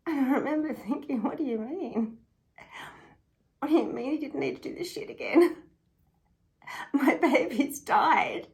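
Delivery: loud, raw, grief-stricken anger; anguished outburst. Retold or imitated. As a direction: cry it out in outraged disbelief. Reliving anger and grief at remark.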